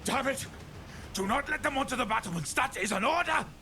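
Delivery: high, frantic commissar's scream; screamed in fear. A narrator's reading. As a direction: scream the order in rising panic. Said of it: "Ulrich screamed, voice cracking in fear".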